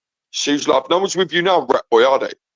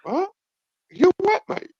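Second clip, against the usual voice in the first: incredulous; 4 dB quieter, slower than usual. Real, not acted. Disbelief at crude question.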